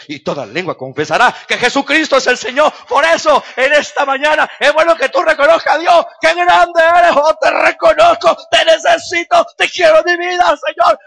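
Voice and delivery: loud, high, pleading preaching prayer; full-force preaching. Acted. Pastor leading the congregation to surrender, shouted prayer. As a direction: cry out to Christ for the people.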